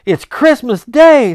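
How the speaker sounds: loud, high, slow joyful cry; overjoyed exclaim. Acted. Scrooge to himself, overjoyed and loud. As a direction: savour the news aloud.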